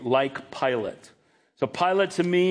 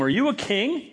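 higher, mildly sarcastic rising question; 6 semitones higher than usual. Retold or imitated. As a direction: ask it with mock disbelief. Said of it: "there's some level of sarcasm".

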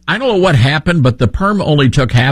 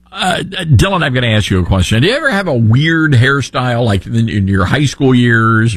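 normal talking voice

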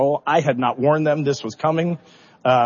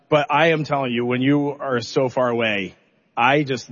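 normal talking voice

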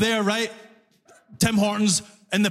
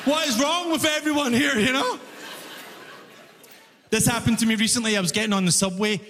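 ordinary talk, nothing marked

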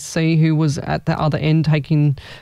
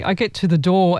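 normal talking voice

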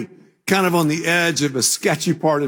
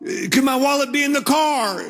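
high-pitched, urgent plea; acted worried question; 9 semitones higher than usual. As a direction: retell an anxious call to a friend. Anxious phone call to friend.

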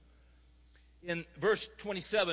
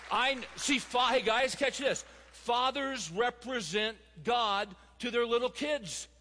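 higher-pitched, emphatic sermon delivery; impassioned preaching; 6 semitones higher than usual. Real, not acted. Preacher urging fathers to represent God, impassioned. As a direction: drive the point home to the congregation.

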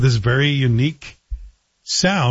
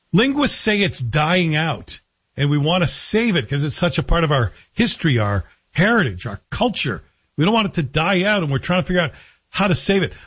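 ordinary delivery

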